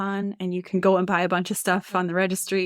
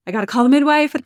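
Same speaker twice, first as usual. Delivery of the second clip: urgent; 5 semitones higher, 9 dB louder, much faster than usual. Retold or imitated. Husband rushing after water breaks.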